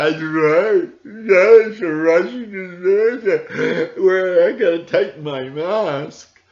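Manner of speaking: loud, high mock agreement; sneering crowd voice, high and loud. Mocking everybody believing the story.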